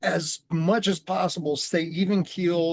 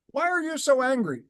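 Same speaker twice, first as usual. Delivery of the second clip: retold needling question, pitched up high; insistent questioning; 8 semitones higher than usual. Group on the hot seat presses him, insistent.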